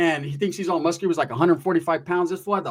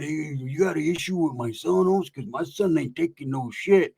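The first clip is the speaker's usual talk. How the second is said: lightly imitated gangster dad, warning; a touch of mock menace, in another voice; angry dad; 2 semitones lower than usual. Dad defends his son, understated mock anger. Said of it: "I can see his dad getting mad".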